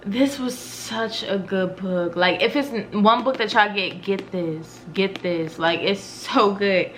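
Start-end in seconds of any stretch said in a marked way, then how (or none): none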